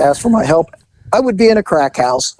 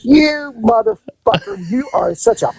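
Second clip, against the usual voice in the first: higher, slow, hammed-up insult; mock fury; 7 semitones higher, slower than usual. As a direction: drag out the insult in fake outrage. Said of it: "she goes".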